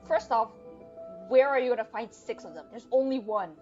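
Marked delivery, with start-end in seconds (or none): none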